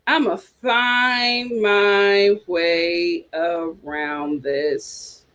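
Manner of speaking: drawn out slow and low, word by word. Pretend. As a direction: stretch every word, dropping low. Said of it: "slowed repeat of the line".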